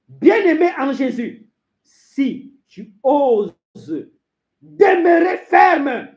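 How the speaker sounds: loud, slow exhortation with long stretched words; forceful preaching. Acted. Preacher urging the beloved to stay firm, forceful. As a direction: cry it out, stretching the key words.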